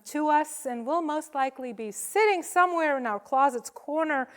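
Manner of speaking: ordinary talk, nothing marked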